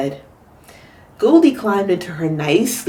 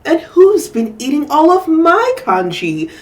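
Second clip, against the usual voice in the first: indignant Missus Chan; 7 semitones higher, 3 dB louder than usual. Acted. Mother echoing complaint.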